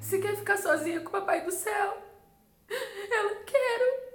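high, tearful voice; breaking into real crying; crying while telling. Real, not acted. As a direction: push the words out through tears. Tearful retelling, crying heard.

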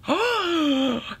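long, high gasp of delight; awestruck gasp. Real, not acted. Vlogger opens pretty packaging, gasping in delight. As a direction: gasp in amazement at something gorgeous.

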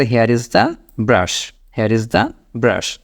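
very slow, each word clear; slow model sentence. Shown as a demonstration. Teacher showing learners what to say at the sink, very slow. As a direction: lay it out slowly to be repeated. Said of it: "ঠিক আছে?".

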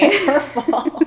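laughing while talking; words broken up by laughter. Real, not acted. Laughing through a teasing line.

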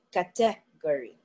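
"category" with the second syllable stressed, ca-TE-gory; Filipino-style stress. Shown as a demonstration. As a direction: land firmly on TE in "category". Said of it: "they put the stress on the second syllable".

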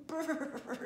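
loud, high trilled brrr; shivering brrr. A narrator's reading. Shivering bear out in the cold.

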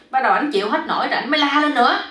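ordinary delivery, nothing marked

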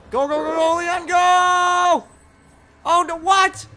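high frantic yelling; panicked shouting. Real, not acted. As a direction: scream it in panic. Panicked fleeing zombies.

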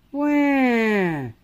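high put-on baby wail. A narrator's reading. Baby Polly crying for her mother, high wail. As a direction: wail like a newborn.

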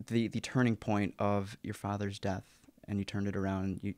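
ordinary delivery

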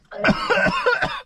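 spluttering cough, food down wrong pipe; choking cough. Real, not acted. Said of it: "down wrong pipe".

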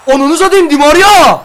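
high, loud outburst; exasperated shout. Real, not acted. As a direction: yell the player's name in frustration. Outburst at disappointing pack. (sinirle bağırış)

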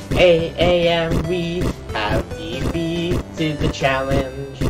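slow, grand victory proclamation; slow announcer voice. Acted. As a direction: announce the win slowly. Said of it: "That didn't really make sense".

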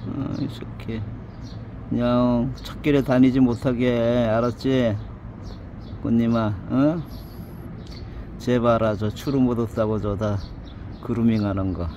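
calling to a cat. Real, not acted. Feeding stray cats, calling out to one fondly. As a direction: call out fondly to the cat nearby.

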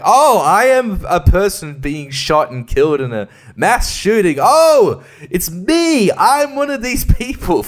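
high, loud hammed-up despair; melodramatic dying victim. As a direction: ham up dying in the shooting. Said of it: "you're like".

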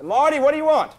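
high, loud snap into the phone; impatient shout. Acted. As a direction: bark it at the pushy caller. Annoyed yell at caller on phone.

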